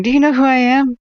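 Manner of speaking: high, loud entitled Karen voice, fading back down; mock Karen. Parodying himself to stream viewers as a Karen, hammed-up entitled rant. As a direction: huff it out like a self-important Karen. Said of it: "I almost sounded like a Karen".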